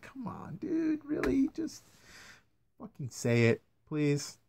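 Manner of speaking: soft, high, pleading; exasperated whine. Real, not acted. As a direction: plead quietly, worn out. Exasperated plea, drops quiet.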